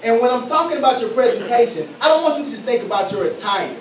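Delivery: ordinary delivery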